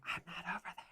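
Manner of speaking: hushed whisper close to the ear; very soft, eerie imitated whisper; creepy whisper. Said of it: "like, whispering in their ear".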